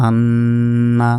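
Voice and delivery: আন্না with the ন্ন held long and nasal; held nasal ghunna. Shown as a demonstration. Teacher to students, demonstrating ghunna with a long nasal hold. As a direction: stretch the doubled ন in আন্না slowly through the nose. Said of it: "তাহলে গুণনা হবে".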